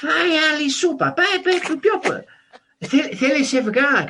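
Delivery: high, loud, insistent Greek food-pusher; Greek host parent. Acted. Greek parents offering leftovers to their dinner guest, high loud mock insistence. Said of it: "you know, the usual thing is".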